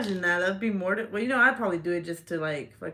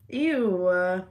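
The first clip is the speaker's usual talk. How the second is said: disgusted ew; 5 dB louder than usual. Real, not acted. Grossed out.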